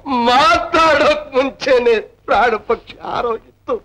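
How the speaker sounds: wailing. Acted. Tenant wails for his dead sister to the landlady. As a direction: wail her name through tears. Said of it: "ಅಳ್ಬೇಡ thumb".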